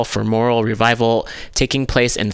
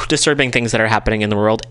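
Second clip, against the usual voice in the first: ordinary talk, nothing marked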